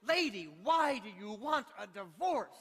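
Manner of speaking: hammed-up frustrated outburst; frustrated judge. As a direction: demand it slowly as a judge at wit's end. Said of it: "in frustration, the judge asked".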